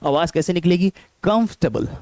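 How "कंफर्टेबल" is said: कंफर्टेबल squeezed to "kumf-tuh-bul"; three syllables. Shown as a demonstration. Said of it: "इसमें तीन syllables हैं".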